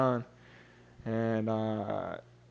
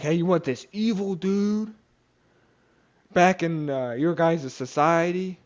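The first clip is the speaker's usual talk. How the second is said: higher-pitched retold appeal to a crowd; Pilate addressing crowd; 6 semitones higher, 3 dB louder than usual. Pilate puts the choice to crowd.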